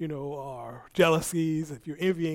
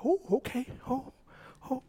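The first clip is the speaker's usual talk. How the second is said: flustered self; 10 semitones higher, 11 dB louder than usual. Retold or imitated. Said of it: "I was like".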